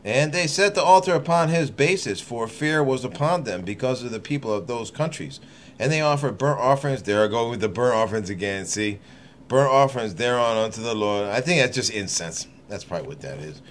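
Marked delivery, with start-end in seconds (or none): 0.0-7.0 measured, solemn Bible reading; deliberate King James recital; scripture reading. Acted. Reads scripture aloud. 9.5-11.3 measured, solemn Bible reading; deliberate King James recital; scripture reading. Acted. Reads scripture aloud.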